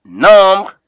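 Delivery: Nombre said "NOM-bruh", r barely sounded; French pronunciation. Shown as a demonstration. Teacher models the next book, Nombre loud with a faint French r. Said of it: "is pronounced the way it is there".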